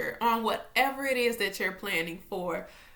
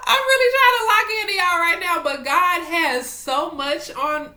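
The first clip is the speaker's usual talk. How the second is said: loud, high, whiny cry easing down; high, loud comic whine, easing off; mock wail; 7 semitones higher, 9 dB louder than usual. Real, not acted. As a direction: wail it out in playful exasperation. Mock-wailing at her scattered mind.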